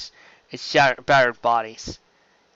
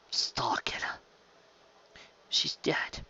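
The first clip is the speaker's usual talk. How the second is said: breathy unvoiced whisper; barely a breath, numb with grief; 11 dB quieter, much slower than usual. Grieving survivor among the dead.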